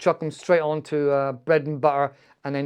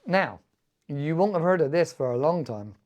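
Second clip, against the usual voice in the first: normal talking voice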